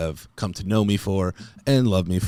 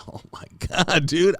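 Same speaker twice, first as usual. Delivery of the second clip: excited; 8 semitones higher than usual. Retold or imitated. Mock amazed reaction.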